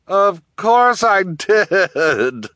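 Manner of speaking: very slow, high, loud gloat; gloating villainess. A narrator's reading. Guardian admitting her scheme to orphans, gloating. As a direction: draw it out with villainous relish.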